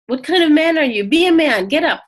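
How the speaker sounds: imitating a mild telling-off; gentle scolding. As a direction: scold lightly, as if rousing someone to act. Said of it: "gently scolding".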